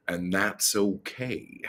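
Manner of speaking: ordinary talk, nothing marked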